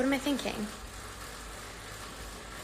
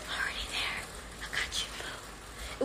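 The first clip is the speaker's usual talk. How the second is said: soft playful whisper; 13 dB quieter, slower than usual. Real, not acted. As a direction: whisper it like a secret. Playful aside to chat.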